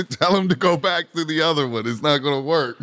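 laughing quote. Retold or imitated. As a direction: giggle while imitating the coach's call. Quoting coach Ron while laughing.

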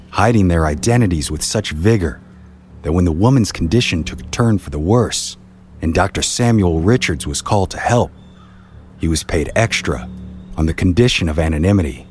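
ordinary delivery, nothing marked

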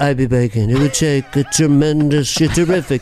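flattering Trump mumble; Trump impression. Trump flattering the crowd, in his voice. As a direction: gush praise in a Trump voice.

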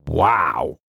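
understated yelp, drawn out; a mild narrated shout. A narrator's reading. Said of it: "shouted James".

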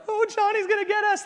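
high, hammed-up fake fear; mock scared kids. Campers faking fright over the camp legend, mock scared. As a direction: pretend to be spooked, not really. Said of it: "like, kinda faking it".

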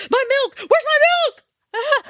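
put-on very high, slow wailing child; imitating toddler panic. Mother imitating her son demanding his milk. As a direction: wail for the milk like a frantic toddler. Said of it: "we'll be going to bed".

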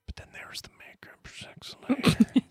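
soft, unvoiced stage whisper; hushed, jokey confidential murmur; whispered aside. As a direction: whisper it like a naughty secret. Racy aside while reading email.

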